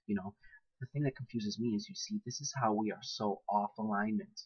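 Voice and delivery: ordinary talk, nothing marked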